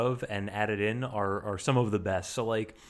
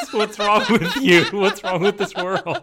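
loud, slow, laughing disbelief; laughing outrage; 5 semitones higher, 10 dB louder than usual. Real, not acted.

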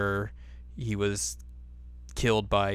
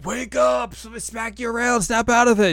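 much higher, urgent snap-out-of-it voice; mock rousing cry; 13 semitones higher, 4 dB louder than usual. Hero rousing his freaking-out friend, hammed-up high cry. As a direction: cry it high, as if shaking someone awake.

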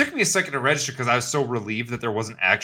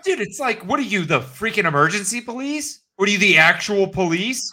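indignant rant; 8 semitones higher than usual. Real, not acted. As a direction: ridicule him in outrage. Ranting at the guy.

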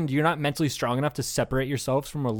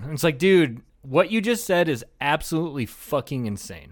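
usual speaking voice

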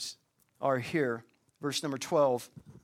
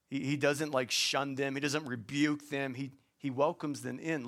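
usual speaking voice

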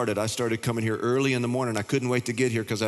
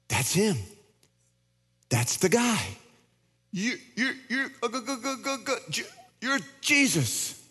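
higher, stammering amazement, fading to a hush; stunned recognition; 10 semitones higher than usual. Acted. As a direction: stammer it out, almost laughing with disbelief. Disciples recognize Jesus, acted.

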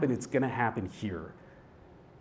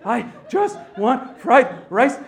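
high, loud sobbing plea; acted crying, word by word; tearful, barely talking; 11 semitones higher, 10 dB louder, much slower than usual. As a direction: force each word out slowly through mock sobs. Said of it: "I can't even talk".

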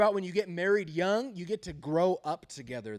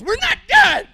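very high, very loud mock yell; imitated angry shout; 18 dB louder, slower than usual. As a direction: shout like a wife shaking her husband awake. Wife shaking him awake mid-argument.

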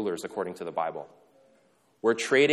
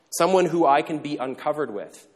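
normal talking voice

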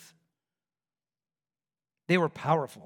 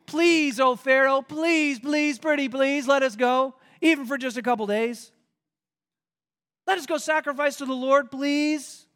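higher, pleading beggar; begging Moses; 7 semitones higher, 4 dB louder than usual. Acted. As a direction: plead with Pharaoh, hammed up.

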